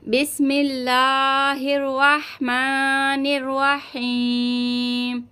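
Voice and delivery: slow, drawn-out Quranic recitation. Shown as a demonstration. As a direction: recite it slowly and melodiously. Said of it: "चलिए आप भी पढ़िए". (धीमी तिलावत)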